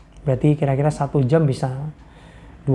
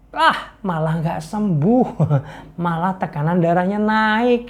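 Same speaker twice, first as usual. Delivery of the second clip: usual speaking voice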